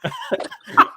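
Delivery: shrieking high laugh; high burst of laughter. Real, not acted. Guest cracking up retelling a meeting, shrieking laugh. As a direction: crack up mid-story.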